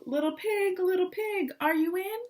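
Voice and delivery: high, loud sing-song call at the door. Acted. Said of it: "so I called".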